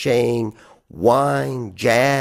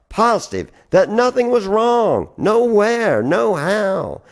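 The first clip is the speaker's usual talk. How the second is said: higher, insistent lift on each denial; manic insistence; 8 semitones higher than usual. Acted. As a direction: push it up like forced cheer.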